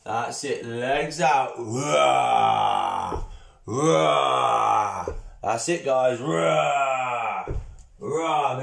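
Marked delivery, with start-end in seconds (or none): 1.6-5.1 playful growling dinosaur roars; T-Rex roar. Pretend. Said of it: "making the noise of the T-Rex". 6.2-8.6 playful growling dinosaur roars; T-Rex roar. Pretend. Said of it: "making the noise of the T-Rex".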